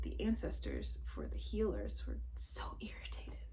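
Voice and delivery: whispering what the ancestors felt; confiding whisper. Real, not acted. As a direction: hush it like sharing a secret.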